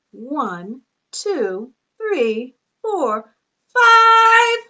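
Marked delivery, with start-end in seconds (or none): 3.7-4.6 excited count. Pretend. Counting up to five.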